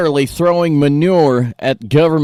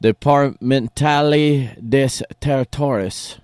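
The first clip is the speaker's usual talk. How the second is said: slow, clumsy attempt at French accent; bad French accent; slower than usual. Host reading a French office's name, in a bad French accent. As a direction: sound out French badly, word by word. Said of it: "my French accent sounds just like my Spanish one".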